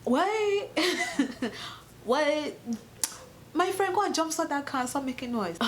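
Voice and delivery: high-pitched mock outrage; acted baffled "what?", then a high telling-off; indignant retort. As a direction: scold him in a high, exasperated voice. Acted indignant retort to eager helper.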